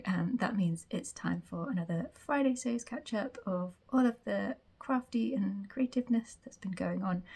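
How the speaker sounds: usual speaking voice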